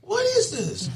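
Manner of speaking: high-pitched mock dismay; dismayed protest. Man recalls protesting his situation, high-pitched dismay. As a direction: squeal it in disbelief.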